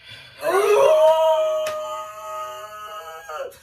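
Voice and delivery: loud, high-pitched drawn-out gasp; long dramatic gasp. Acted. Listener shocked by a question about his name, long gasp.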